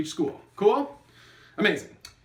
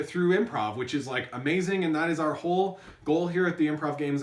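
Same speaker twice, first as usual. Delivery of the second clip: ordinary talk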